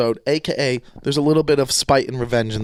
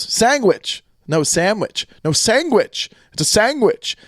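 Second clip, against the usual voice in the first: higher, "sandwich" said as "sangwich"; Italian dad accent; 6 semitones higher, slower than usual. Retold or imitated. Host imitating the sandwich argument with his dad, Italian accent. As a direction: insist on "sangwich" like an Italian father. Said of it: "in Italy we say sandwich".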